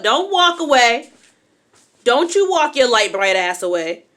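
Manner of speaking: sassy, stretched scolding call. Real, not acted. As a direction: raise your voice, stretch it: don't you leave. Calls after partner walking off.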